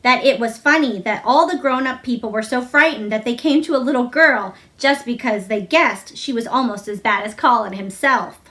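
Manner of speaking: ordinary delivery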